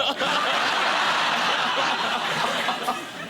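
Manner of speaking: long airy laugh; breathy laughter. Real, not acted. Guest laughing about the monkey, breathless laugh.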